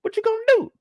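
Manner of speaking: high-pitched mocking imitation of Gidget. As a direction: squeak it out like a taunting friend. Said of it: "She talking about".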